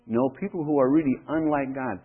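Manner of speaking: normal talking voice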